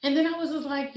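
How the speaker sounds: ordinary talk, nothing marked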